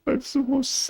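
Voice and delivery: laughing through the words; laughing disbelief. Real, not acted. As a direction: say it while cracking up. Laughing at sexist jeans joke.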